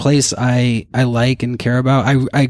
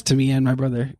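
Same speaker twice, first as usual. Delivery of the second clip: ordinary delivery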